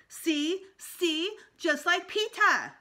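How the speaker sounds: put-on high, loud and slow proud toddler. A narrator's reading. Fudge boasting to the dentist in a high toddler voice. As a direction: crow it like a proud little boy.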